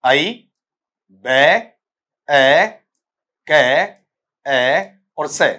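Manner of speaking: आई, बे, ए, के split into separate sounds with pauses. Shown as a demonstration. Teacher breaking abacus into sounds for the class. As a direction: break the word into its single sounds, slowly. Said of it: "शुरू में sound क्या है".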